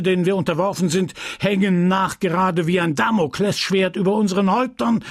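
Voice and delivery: the pharmacist fuming at his apprentice; a lightly voiced furious old apothecary; angry apothecary. Homais ranting.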